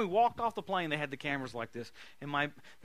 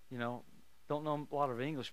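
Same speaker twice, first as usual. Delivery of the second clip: ordinary delivery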